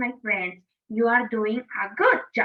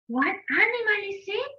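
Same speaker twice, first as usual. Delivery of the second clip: normal talking voice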